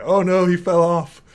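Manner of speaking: high, loud "oh no"; sudden fake panic; mock alarm. Player reacting to the dropped call, mock alarm. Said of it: "I was like".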